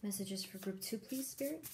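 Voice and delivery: soft, murmured request to Spirit; quietly calling on spirit guides over the cards; hushed asking. Real, not acted. Murmured questions to Spirit while shuffling.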